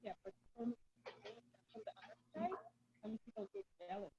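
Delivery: muffled, far-off phone voice. Real, not acted. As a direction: sound muffled through a wall. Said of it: "You're muffled".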